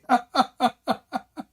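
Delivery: high-pitched, tailing-off giggles; high helpless laughter. Real, not acted. Host at the opponent's exit, helpless laughter. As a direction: laugh until the breath runs out.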